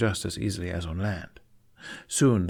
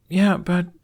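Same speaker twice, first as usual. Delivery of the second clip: put-on young boy voice; 10 semitones higher, 6 dB louder than usual. Jamie voiced.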